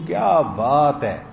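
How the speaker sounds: slow, drawn-out admiring praise; marvelling, slowly savoured compliment; admiring amazement. Shown as a demonstration. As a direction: stretch it out in wonder and praise. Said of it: "اس کا مطلب میں تعجب میں، تعریف میں کہہ رہا ہوں".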